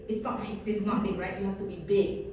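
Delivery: ordinary talk, nothing marked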